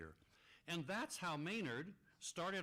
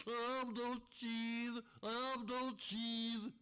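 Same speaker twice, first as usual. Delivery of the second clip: hammed-up blubbering moose, pitched up and slow; a mock boo-hoo wail, drawn out; sobbing and blubbering; 9 semitones higher, much slower than usual. Puppet moose mock-sobbing through the forest over his cheese. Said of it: "sobbing and blubbering".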